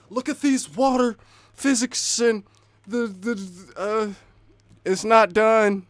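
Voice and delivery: high, loud imitation of online complainers; mock outcry. Mimicking Twitter users griping about water physics, loud mock outcry. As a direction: mimic a loud complaint, dragging out the last words.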